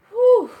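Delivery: loud, high "whew"; high burst of emotional release; overwhelmed whew. Real, not acted. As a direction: blow out a big high whoop of relief. Overcome, does not know what to do.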